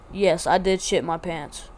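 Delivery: usual speaking voice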